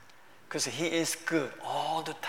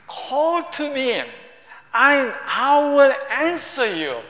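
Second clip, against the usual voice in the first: proclaiming scripture; 8 semitones higher, 8 dB louder, slower than usual. Acted. Preacher proclaims the scripture verse.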